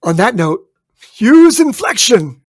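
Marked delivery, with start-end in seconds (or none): 1.2-2.4 "use inflection" swung high and loud; exaggerated inflection. Shown as a demonstration. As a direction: declaim "use inflection" slowly with big pitch swings. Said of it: "Y-, your inflection is gonna".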